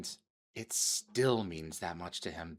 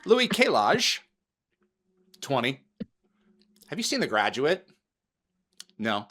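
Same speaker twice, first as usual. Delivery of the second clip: imitating her; 3 semitones higher, 7 dB louder than usual. Imitates co-host answering French fan.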